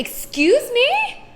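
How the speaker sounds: high, slow, indignant excuse me; the mother bristling at the father's joke; offended mother. Acted. Mother reacting to the father's answer, slow and indignantly high. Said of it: "then the mother is like".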